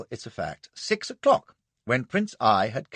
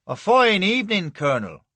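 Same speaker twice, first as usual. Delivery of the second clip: Irish trooper; 7 semitones higher, 6 dB louder than usual. A narrator's reading. Nolan greets the colonel.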